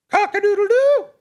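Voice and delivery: high, loud, short rooster crow. A narrator's reading. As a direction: crow with growing confidence. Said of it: "a little louder".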